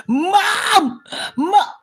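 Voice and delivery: high, loud squeal for mum; mock call to mom. Youtuber shrieking for mum over four likes, hammed-up. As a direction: shriek for mum like a kid with big news.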